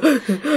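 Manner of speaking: sharp inward gasp of inner panic; quick rising mock gasp; panicked gasp. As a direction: gasp as if the nerves hit. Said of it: "Und innerlich war ich so".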